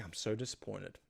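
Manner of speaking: low, hushed letdown in a retold quote; quiet disappointment. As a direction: drop down low and soft, lightly acting disappointed. Retold disappointed reproach to visitor.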